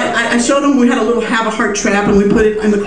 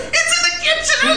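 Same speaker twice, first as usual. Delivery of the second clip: panicked; 3 dB quieter than usual. Retold or imitated. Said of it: "He goes".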